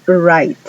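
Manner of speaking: right said "rait", no W; English word write. Shown as a demonstration. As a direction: silent W in right. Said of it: "la W no suena". ("right" sin la W)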